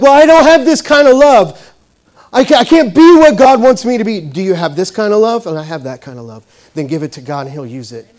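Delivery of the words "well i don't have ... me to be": loud, high mock objection; protesting doubter. Listener objects to God's call, loud mock protest. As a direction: imitate someone exclaiming they can't do it.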